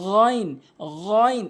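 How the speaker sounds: "গইন" with throaty gargled gh; correct pronunciation. Shown as a demonstration. Teacher models the Arabic letter, gargled "গইন". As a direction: rasp the first sound of "গইন" from the throat. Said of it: "এভাবে উচ্চারণ করবেন".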